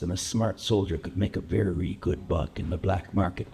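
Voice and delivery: low, controlled gangster monologue; low-voiced threat from a hardened veteran; quietly menacing biker. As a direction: keep it deep and even, making them lean in. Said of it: "leaning in to hear me now".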